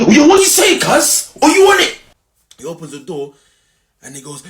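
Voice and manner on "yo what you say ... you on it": high, loud street challenge yelled out; angry shout. Retold or imitated. Shouting down the rude bus driver, squaring up for a fight. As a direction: yell it like you're squaring up. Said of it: "that zone's already kicking in".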